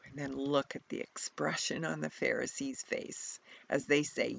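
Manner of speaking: ordinary talk, nothing marked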